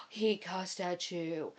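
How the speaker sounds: slow, hushed shocked tease; mock scandalized. Family teasing the wife at dinner, mock shock.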